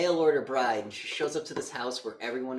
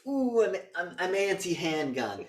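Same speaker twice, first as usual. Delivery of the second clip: nervous mock whine; 7 semitones higher, 4 dB louder than usual. Voicing Richard Lewis's nervous character.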